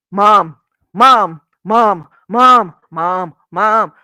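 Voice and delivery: loud repeated calling of mom; kids calling mom. Retold or imitated. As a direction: call mom like an impatient child.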